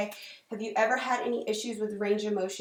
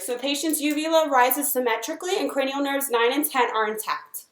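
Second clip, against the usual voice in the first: clinical dictation; 3 semitones higher, 7 dB louder than usual. Acted. Dictating exam findings aloud.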